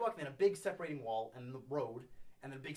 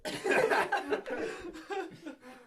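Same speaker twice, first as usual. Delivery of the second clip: high laugh; 12 semitones higher, 3 dB louder than usual. Real, not acted. Laughing at the end of story.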